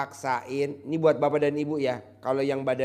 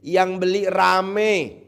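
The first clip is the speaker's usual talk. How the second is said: loud, hammed-up sermon voice; mock preaching; 4 semitones higher, 6 dB louder, slower than usual.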